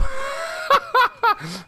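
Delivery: long, high cackle; real high-pitched laughing fit; cracking up. Real, not acted. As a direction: burst out laughing hard. Cracks up at score.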